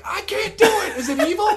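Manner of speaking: loud, very high protest; anguished hero. Retold or imitated. Hero refusing to slay the ant, loud and anguished. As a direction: cry out in hammed-up anguish. Acting out Finn's reluctance.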